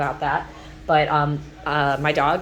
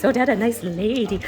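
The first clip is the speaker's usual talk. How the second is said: a touch higher cooing praise; 4 semitones higher than usual. Real, not acted. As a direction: coo a little praise to the puppy. Praising the dog.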